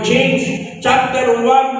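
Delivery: slow, sung-out scripture reference; drawn-out verse citation. Acted. Preacher announcing the Bible verse, slowly sung out. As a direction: stretch each word like announcing from the pulpit. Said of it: "stretched reference announcement".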